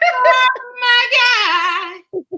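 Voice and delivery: high, long drawn-out church wail; hammed-up church mother overcome with amazement; mock astonishment. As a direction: stretch it out high, almost singing. Church mother reacts to forty dollars.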